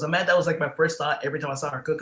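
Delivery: ordinary delivery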